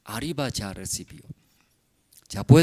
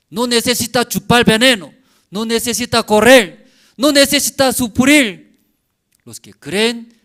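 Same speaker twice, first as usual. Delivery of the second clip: much higher and louder, shouting the promise; full-force preaching; 10 semitones higher, 15 dB louder than usual. Acted. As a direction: cry it out like a revival preacher. (predicación a todo pulmón, gritando la promesa)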